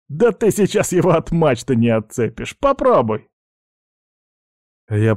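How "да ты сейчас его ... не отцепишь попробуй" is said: high, rudely amused challenge; derisive. Acted. Pirate mocking his worried friend, derisive. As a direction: scoff and dare him, pitch climbing. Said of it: "невежливо расхохотался".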